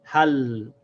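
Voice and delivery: "hal" said as one syllable stopping on l; demo syllable hal. Shown as a demonstration. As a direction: join ha and l into "hal". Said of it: "we read the two of them together".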